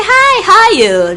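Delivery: put-on British accent. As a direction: imitate a Nigerian trying to sound British. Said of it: "trying to speak with a British accent".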